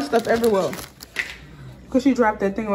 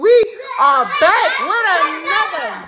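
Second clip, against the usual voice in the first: high, drawn-out show opener; vlogger intro; 6 semitones higher, slower than usual. Real, not acted. Host welcoming YouTube family back, hyped intro. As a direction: stretch the greeting high, landing slowly on the end.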